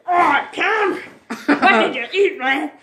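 mock teacher. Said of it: "He said".

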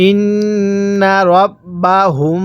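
"ইন্না রব্বাহুম" recited slowly with long nasal hums on nn and final m; ghunnah. Shown as a demonstration. Teacher showing how to read it, "ইন্না রব্বাহুম" with sustained ghunnah. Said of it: "তাহলে আমার সাথে পড়ুন".

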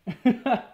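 disbelieving laugh. Real, not acted. Area feels super unfair.

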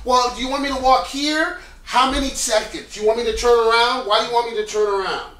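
the most annoying actor on set, bombarding questions; clueless annoying actor. Shown as a demonstration. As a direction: play dumb and pester with questions. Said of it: "I'm like".

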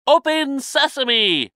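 high, slow, loud magic call. A narrator's reading. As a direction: call to the rock, drawn out. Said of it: "he called".

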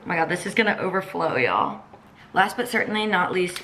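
ordinary talk

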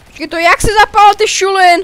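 excited high exclaim; excited shout. Real, not acted. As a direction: call out thrilled to light it too. Excited at fire in game.

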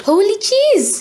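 exclamation. A narrator's reading. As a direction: blurt it out, amazed. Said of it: "Pam exclaimed".

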